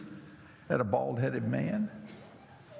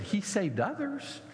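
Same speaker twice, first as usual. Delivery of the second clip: mocking quote; 10 semitones higher than usual. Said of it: "those who stood at the foot of the cross and said".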